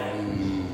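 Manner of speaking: long, low, soft puzzled hum; mock pondering hum. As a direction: hum low like stumped kids. Children stumped by the question.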